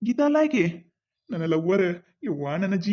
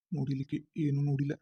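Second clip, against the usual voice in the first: much softer, lower, fast aside; relieved aside; 7 semitones lower, 9 dB quieter, much faster than usual. Acted. Husband relieved the wife saw nothing, soft fast aside. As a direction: mutter it quickly under your breath.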